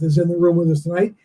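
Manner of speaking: ordinary delivery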